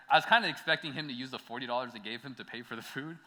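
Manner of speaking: normal talking voice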